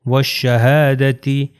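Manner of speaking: والشہادتی slowly as "wash-shahaadati"; والشہادتی recited slowly, lam silent; waw joined to sheen. Shown as a demonstration. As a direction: draw out والشہادتی with a doubled sh. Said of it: "یہ شین سے مل جائے گا".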